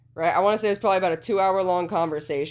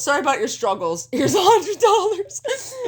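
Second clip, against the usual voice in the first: high, laughing mock-dad voice, slowing down; 10 semitones higher, 4 dB louder than usual. Teasing his friend, mimicking the dad's hundred dollars, high and laughing. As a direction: ham up a dad buying his way out, cracking up.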